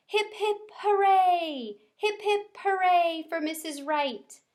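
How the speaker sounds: high chanted cheer. A narrator's reading. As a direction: cheer as a class. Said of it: "cried out".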